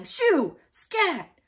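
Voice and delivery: loud, high shooing yell. A narrator's reading. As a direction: chase off the cat with a yell. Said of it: "waving his arms and yelling".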